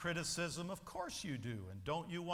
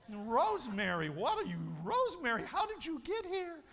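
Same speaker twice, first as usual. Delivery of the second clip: higher, louder surprised greeting; delighted surprise; 6 semitones higher, 3 dB louder than usual. Acted. Adult child surprised to see a relative, bright and high. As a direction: gasp their name in happy surprise.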